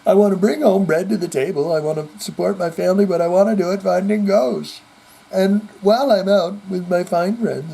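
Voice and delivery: husband pleading on TV, hammed-up whiny sob voice; mock crying Zach. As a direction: ham up a weepy ghost hunter begging. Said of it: "crying, going".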